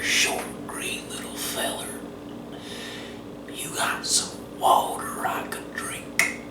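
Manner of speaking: scared little whisper to a strange creature; whispered plea for water; timid whisper. Retold or imitated. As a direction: barely dare to ask. Quoting himself to the little creature.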